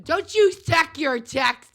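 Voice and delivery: hammed-up shout; mock outburst. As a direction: drag it out as a playful scold. Sudden teasing outburst.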